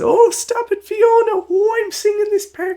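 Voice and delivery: very high squeaky falsetto; shrill puppet lady arguing. Retold or imitated. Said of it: "different voice actors for the two".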